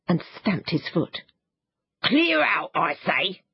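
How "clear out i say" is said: mild mock yell; shouted. A narrator's reading. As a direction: bark it at the children in a hammed-up temper. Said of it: "he shouted".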